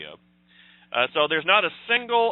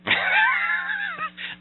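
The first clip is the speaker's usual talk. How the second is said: high burst of laughter, almost a shout; shrieking laugh. Real, not acted. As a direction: explode laughing at the punchline. Reacts to Cleveland joke.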